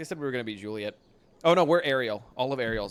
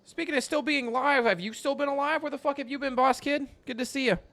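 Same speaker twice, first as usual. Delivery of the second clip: much higher, delighted welcome; excited greeting. Real, not acted. Greeting a returning friend.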